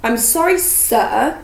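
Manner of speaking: weight on "sir", set apart; stress on last word. A narrator's reading. Harry answering Hagrid back, "sir" pointedly stressed. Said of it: "emphasising the last word".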